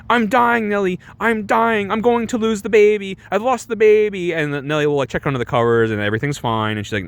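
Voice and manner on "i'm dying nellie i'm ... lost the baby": high, mock-dying lament; melodramatic wailing. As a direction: overact a dying woman's complaint. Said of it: "applying the melodramatics".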